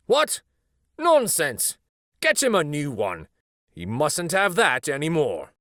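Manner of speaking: high, loud and emphatic, a fussy doctor; doctor's brisk order. A narrator's reading. Doctor dismissing Nana, loud and emphatic. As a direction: sweep the objection aside with alarm.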